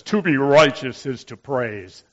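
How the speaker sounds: a hint of stern brother; clipped firm rebuke; stern brother correcting him. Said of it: "so sternly and says".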